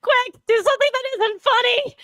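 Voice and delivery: high, teary laughing plea; begging through laughter. Real, not acted. Still laughing, pleading.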